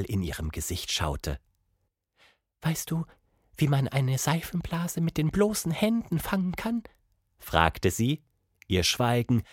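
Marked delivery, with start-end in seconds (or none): 2.6-6.8 soft, high voice of a downcast monkey; timid, high-pitched monkey question; sad monkey. A narrator's reading. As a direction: ask it softly in a high creature voice. Monkey figure's dialogue.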